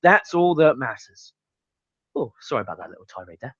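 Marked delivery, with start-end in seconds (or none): 2.2-3.5 low, hushed, quick apology; sheepish aside. Real, not acted. Reviewer apologising for his tirade, sheepishly quiet. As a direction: drop right down after a rant.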